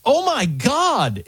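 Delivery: high, drawn-out astonishment; amazed exclamation. Retold or imitated. Host quoting the computer's response, high and astonished. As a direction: draw it out high, as if all caps. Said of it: "with all caps and an exclamation point".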